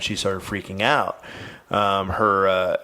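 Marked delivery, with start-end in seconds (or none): none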